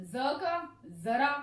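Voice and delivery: ज का जरा drawn out slowly with a buzzing z; slow demo of z. Shown as a demonstration. Teacher models the borrowed z sound slowly. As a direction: stretch ज का जरा, voicing the z. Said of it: "और इसी तरह से ज".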